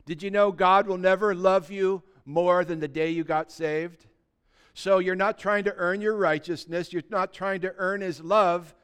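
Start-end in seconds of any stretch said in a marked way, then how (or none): none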